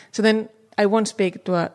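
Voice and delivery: ordinary talk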